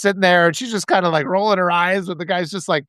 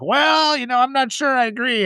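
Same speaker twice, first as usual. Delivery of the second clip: grizzled driver; 6 semitones higher than usual. Retold or imitated. Shuttle driver to captive riders, grizzled disagreement. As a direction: imitate a contrary old-hand driver. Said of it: "he's, like, grizzled".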